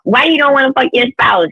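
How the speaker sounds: ordinary talk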